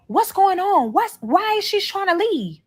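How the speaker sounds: high-pitched retold questions; alarmed questioning. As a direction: imitate a mother demanding an explanation. Mother questioning son on phone.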